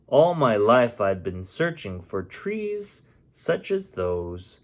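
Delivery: plain reading voice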